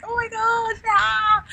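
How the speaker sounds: 1 squealing excitement. Pretend. Voicing excited inner child.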